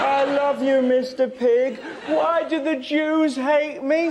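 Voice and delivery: a sandwich crooning to its bacon; hammed-up loving little voice; tender bread voice. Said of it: "bread giving a dead pig a cuddle".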